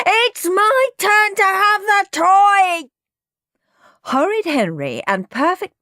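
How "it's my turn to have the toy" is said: slow, high, loud wail of protest; screaming. A narrator's reading. Both brothers to Mum, holding on to the toy, screaming. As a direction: scream every word out. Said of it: "screamed Henry and Peter".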